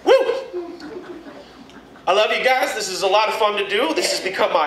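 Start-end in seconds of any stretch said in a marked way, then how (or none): 0.0-0.4 a high, loud whoop at the crowd; excited whoop. Real, not acted. Comedian whooping at his crowd, high and loud. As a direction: whoop to fire up the room.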